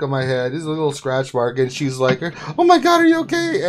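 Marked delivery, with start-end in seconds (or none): 2.6-3.7 high alarmed wife voice, much higher; husband imitating his alarmed wife, high-pitched. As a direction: squeak it out like a worried wife. Wife voiced in retold story.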